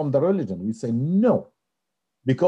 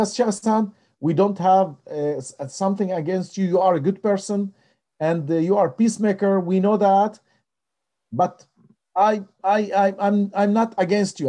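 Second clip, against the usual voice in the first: higher, louder, earnest rabbi reassuring; voicing the rabbi; 9 semitones higher, 4 dB louder than usual. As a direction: lift the voice to play the rabbi pleading his goodwill. Rabbi voiced in retold call.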